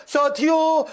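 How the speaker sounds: high, loud, drawn-out "साथियों"; slow orator. Retold or imitated. Coach mimics speakers who waste time, slow drawn-out address. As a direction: stretch it like a time-wasting speaker. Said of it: "धीरे से बोलते".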